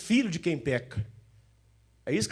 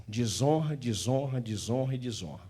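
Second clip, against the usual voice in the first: fading repetition; 6 semitones lower, 5 dB quieter than usual. Acted. Repeated word fading.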